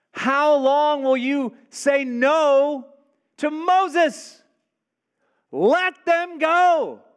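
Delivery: high, loud, slow plea; pleading shout. Acted. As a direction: cry out to the king, drawn out. Servants pleading with Pharaoh, preaching.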